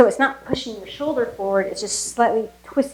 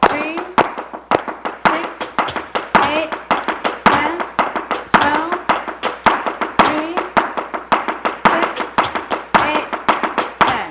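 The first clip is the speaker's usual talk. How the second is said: loud, then higher spaced count calls; counting the compás; 6 semitones higher, 4 dB louder, slower than usual. Shown as a demonstration. Teacher counting the left-side drill for students, rhythmic calls. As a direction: drive the rhythm with each number.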